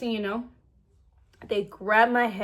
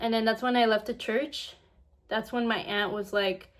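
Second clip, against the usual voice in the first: ordinary talk, nothing marked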